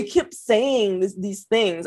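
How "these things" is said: ordinary talk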